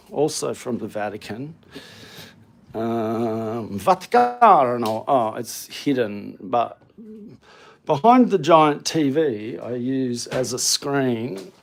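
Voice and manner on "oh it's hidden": usual speaking voice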